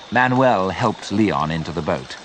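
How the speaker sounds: ordinary delivery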